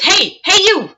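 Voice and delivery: high, mock holler for attention; calling out. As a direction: imitate someone hailing a stranger. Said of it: "he calls out to me".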